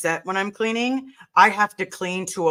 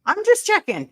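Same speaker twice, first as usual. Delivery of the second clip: much higher, sing-song check-in; mock concern; 6 semitones higher than usual. Teasing her hyper friend with high mock concern. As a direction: tease gently, high.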